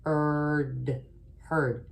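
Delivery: "heard" stretched with a soft ER sound; wrong sound-out. Shown as a demonstration. Teacher sounding the mistaken ER in "heard". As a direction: show the wrong ER spelling sound. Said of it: "E R says".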